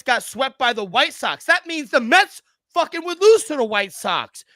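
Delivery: loud, high, exasperated ranting; angry rant. Real, not acted. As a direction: vent your fury at the team. Ranting about the Mets' losing streak.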